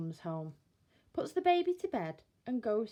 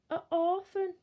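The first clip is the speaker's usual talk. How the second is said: gruff pitying burglar; 10 semitones higher, 6 dB louder than usual. Acted. Bill pities the baby.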